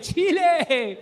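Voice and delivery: high, loud stadium roll call, drawn out near the end; hammed-up shout-out of each country; mock roll call shout. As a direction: yell every country to the crowd. Voicing [name] listing countries.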